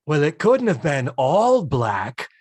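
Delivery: slow, dry deadpan doubt; Isabel Sanford impression. Louise doubting Lillian, slow and dry. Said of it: "nailing your Isabel Sanford".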